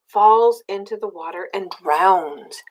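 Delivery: ordinary delivery, nothing marked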